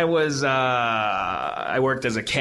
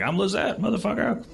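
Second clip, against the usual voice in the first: higher-pitched swaggering comeback; mock bravado; 11 semitones higher than usual. Host acts out a comeback for Lizette, cocky and high. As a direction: pretend to announce yourself boldly, pitched up. Suggested bold comeback, acted out.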